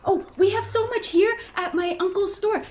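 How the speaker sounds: put-on high, loud little boy pitch; a little gnome boy showing off his uncle's store; eager gnome boy. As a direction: brag like a proud small child.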